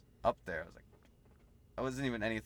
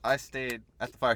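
normal talking voice

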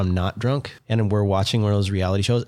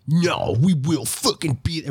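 slow, a touch higher meathead fan voice; mock sports fan; 5 semitones higher, slower than usual. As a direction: lightly ham up a die-hard Lakers fan. Mocking Lakers fans.

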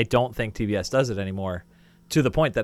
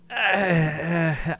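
drawn-out higher-pitched groan of dread; uneasy groan; 6 semitones higher, 3 dB louder than usual. Real, not acted. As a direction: groan at the very idea. Dreading a sequel to a classic.

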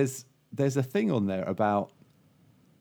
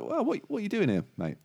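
higher, chatty barman; a higher pub landlord voice; 11 semitones higher than usual. Acted. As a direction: voice the curious barman. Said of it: "He's like".

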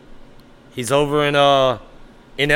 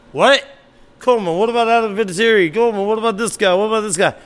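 mock outrage; 7 semitones higher, faster than usual. Mock objection from imagined caller.

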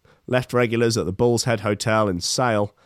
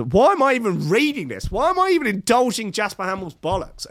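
exasperated; 10 semitones higher than usual. Real, not acted. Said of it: "This is like an abusive relationship".

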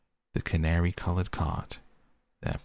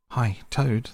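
raised, higher-pitched hail; brisk cry to someone at a distance; calling out; 6 semitones higher, 4 dB louder than usual. A narrator's reading. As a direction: call across to get a friend's attention. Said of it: "they cried".

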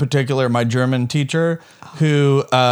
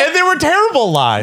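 laughing; 13 semitones higher, 4 dB louder than usual. Real, not acted. Laughing at himself.

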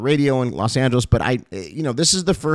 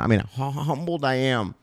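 softer, holding back tears; quiet thanks, fighting to keep composure; emotional and hushed, near tears; choked up; 2 semitones higher, 5 dB quieter than usual. Real, not acted. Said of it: "I don't wanna get emotional right now".

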